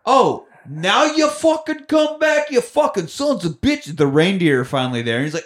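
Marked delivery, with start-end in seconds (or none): 0.0-4.0 high, drawn-out cursing; mock scolding. Santa cursing late reindeer, high mock anger. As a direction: rant in a pitched-up voice.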